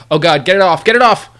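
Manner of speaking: panicked, rising to a yell; shaking off a spider. Pretend. Acting out fear of E.T.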